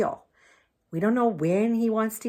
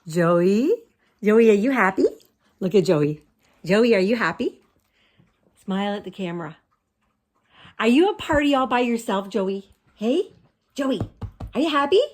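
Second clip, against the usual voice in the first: pet talk; 2 semitones higher, 4 dB louder than usual. Real, not acted. Calling and cooing to dog.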